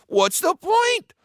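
very high-pitched pirate moan; hammed-up squeaky grumble; whining complaint. A narrator's reading. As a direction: protest as a disheartened crewman. Discouraged crewman complains.

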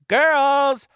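very high, loud battle cry; woman pirate captain's shriek; calling to crew. A narrator's reading. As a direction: holler the order across deck. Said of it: "called to her crew".

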